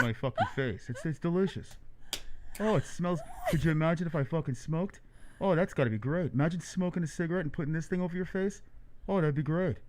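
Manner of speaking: muffled talk through a face mask. Acted. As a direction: talk to a friend from behind a mask. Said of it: "I fucking talk like this when I have the mask on".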